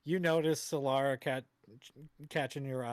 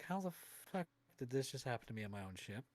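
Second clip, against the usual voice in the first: bewildered mutter; 2 semitones lower, 9 dB quieter than usual. Acted. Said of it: "he's just kinda bewildered".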